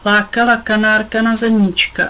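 ordinary delivery

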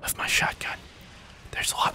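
breathy whisper, barely audible; faint voice. Pretend. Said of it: "faint, faint voice".

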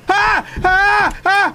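three short high screams; startled screams. Real, not acted. Player hit by a scare, startled screams. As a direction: yelp sharply in fright, high each time. Jump scare in horror game.

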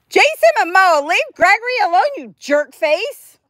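playful scolding. Real, not acted. Scolding her rooster Jason for bullying Gregory, high playful scold. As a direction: shoo off the bully with a play-angry squeal.

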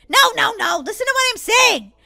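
loud, high-pitched protest; exasperated. Acted. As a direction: cut in, frustrated at not being heard. Sisters squabbling over food.

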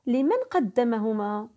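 ordinary delivery

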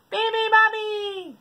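slow, high trumpet call. Acted. Elephant wakes the bird, trumpeting. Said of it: "trumpeted the elephant".

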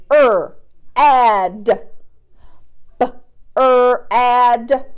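B-er-ad stretched into separate sounds, slowly. Shown as a demonstration. Teacher sounding out a word for young pupils, stretched. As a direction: sound out B-er-ad segment by segment. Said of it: "Tell me what word I've got".

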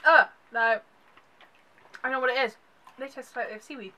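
talking while chewing candy; disgusted grimace while chewing; mouth full, chewing. Real, not acted. Seen 0: Left speaker ate candy at -5, grimaces and works mouth while talking.

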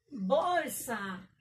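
"Bolsa" stretched slowly into bol-sa; syllable by syllable. Shown as a demonstration. Teacher sounding out a word to count its syllables, syllable by syllable. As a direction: draw out both syllables of "Bolsa". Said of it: "tiene dos sílabas".

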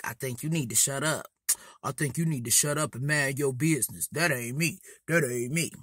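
put-on low, quick, muttered boy's warning; warning classmate. Embarrassed boy telling the girl to shut up, low quick warning. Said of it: "he keep trying to warn me".

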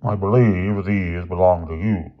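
slow, stern headmaster voice; grim headmaster. A narrator's reading. Headmaster confronting two boys with evidence, slow and grim. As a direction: draw the words out gravely. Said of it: "the headmaster said grimly".

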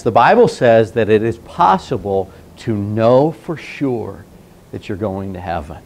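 normal talking voice

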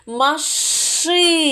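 slow stressed model word. Shown as a demonstration. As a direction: lean on ши in Машина. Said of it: "Слухайте уважно, на який склад".

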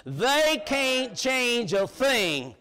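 each word spaced, very slow; emphatic slow. Real, not acted. Preacher reassuring the church about Washington, very slow emphatic. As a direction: hammer they can't change a thing slowly. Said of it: "got good news for you".

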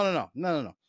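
normal talking voice

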